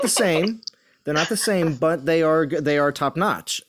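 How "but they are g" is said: ordinary talk, nothing marked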